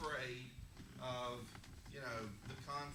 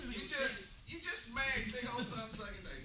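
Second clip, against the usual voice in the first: teasing repeat; 8 semitones higher, 5 dB louder than usual. Real, not acted. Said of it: "repeats louder, higher".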